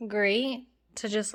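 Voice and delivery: normal talking voice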